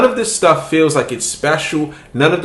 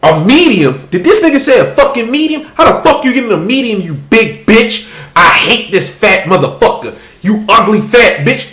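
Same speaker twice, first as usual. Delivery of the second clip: louder, higher jeering troll voices with cackles; mocking online trolls; 5 semitones higher, 4 dB louder than usual. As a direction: jeer like a pile of hateful commenters.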